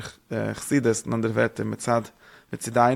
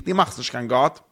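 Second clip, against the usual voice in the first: ordinary delivery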